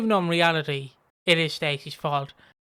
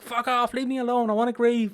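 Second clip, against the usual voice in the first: higher, annoyed brush-off; hammed-up grieving teen snapping; mock angry protest; 6 semitones higher than usual. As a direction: shove him away with your voice. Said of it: "I'm like".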